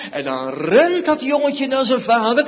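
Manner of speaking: ordinary talk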